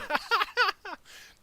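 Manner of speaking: long high laugh falling away; delighted high laugh. Real, not acted. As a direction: laugh hard with glee. Laughing at own trick.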